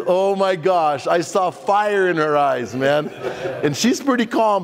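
ordinary delivery

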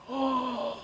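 sharp high mock gasp. Preacher reacting to the insult, a mock gasp. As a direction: gasp in put-on shock.

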